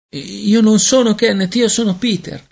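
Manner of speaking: very high-pitched little voice; protesting baby. A narrator's reading. Baby Peter protests he isn't Kenneth. (vocina acutissima che protesta)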